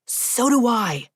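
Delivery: frustrated exclaim. A narrator's reading. Edward insisting to Vivian, frustrated exclaim. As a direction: lightly push back in frustration. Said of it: "he exclaimed, frustration in his tone".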